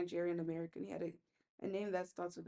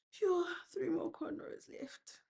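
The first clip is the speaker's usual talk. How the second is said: tired whispered sigh; 9 semitones higher, slower than usual. Real, not acted. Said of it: "tired from braiding".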